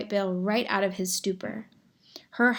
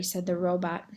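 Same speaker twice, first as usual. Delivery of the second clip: usual speaking voice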